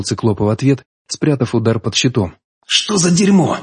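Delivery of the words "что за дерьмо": a hint of a hissed curse, pitched up. A narrator's reading. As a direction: hiss it through the strain of the blow. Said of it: "прошипел я".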